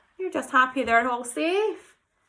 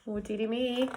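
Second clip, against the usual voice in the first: plain reading voice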